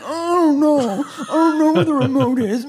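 wife mimicking husband who can't find the remote, dopey voice; mocking husband. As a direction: play him as helpless and dim. Husband's clueless reply mocked.

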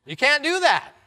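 loud, high protest; shouting. Acted. Bewildered passenger shouting at the pastor, loud. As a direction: shout it in disbelief. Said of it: "he shouted".

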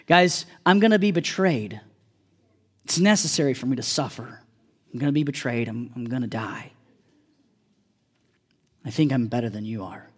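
weary Jesus. Retold or imitated. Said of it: "He's like".

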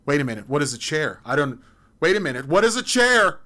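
fast, loud, rising to a high shout; alarmed confusion. Acted. Confused person demanding to know what a chair is, in a rising shout. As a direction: suddenly panic about what a chair is.

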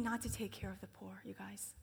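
hushed aside; whisper. Real, not acted. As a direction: lean in and whisper it. Confiding aside to congregation.

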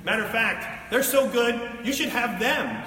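normal talking voice

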